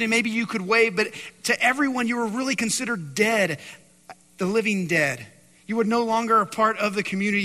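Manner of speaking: loud, rising preacher's build; full-force preaching. Acted. Preacher driving home leprosy's horror to the congregation, loud and insistent. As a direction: pound home the outcast's fate.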